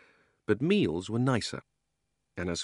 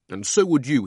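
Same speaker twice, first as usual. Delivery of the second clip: put-on higher, loud boyish protest; loud miserable outburst; 6 semitones higher, 9 dB louder than usual. Boy defends his crying to the girl, loud protest. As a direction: throw it back at her loudly. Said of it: "in a much louder voice".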